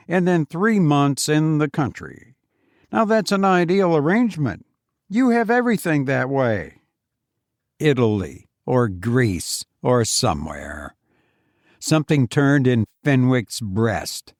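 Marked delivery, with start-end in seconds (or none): 0.1-6.8 a higher, self-satisfied voice; Foster's voice. Acted. Boastful visitor lectures his old friend, higher and self-satisfied. 7.8-10.9 lower, bitter repeat of the boast; Fenwick's bitter echo. Acted. Fenwick repeats Foster's boast to himself, lower and bitter.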